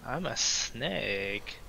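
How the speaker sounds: soft, very slow silly snake; playful snake voice. Pretend. Player repeating the snake bit to crewmates, soft and drawn-out. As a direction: draw it out softly as a mock snake.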